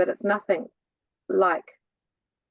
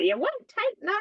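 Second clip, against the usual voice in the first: annoyed mum; 13 semitones higher than usual. Retold or imitated. Daughter quoting her mum's telling-off, mock annoyed. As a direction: imitate an exasperated mother, pitched right up. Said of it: "would get very annoyed with me".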